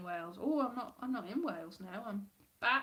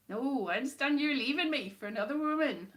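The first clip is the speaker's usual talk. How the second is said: higher, louder accidental West Country accent; 3 semitones higher, 6 dB louder than usual. Shown as a demonstration. Performer auditions a break-up monologue, botched West Country accent. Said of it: "I'm doing a West Country accent again".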